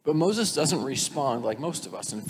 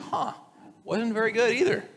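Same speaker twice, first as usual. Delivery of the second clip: high, mocking shrug of a voice; unimpressed huh; 9 semitones higher than usual. Preacher imitating us looking back, unimpressed. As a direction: scoff at the old stuff, pitched up. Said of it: "you're like".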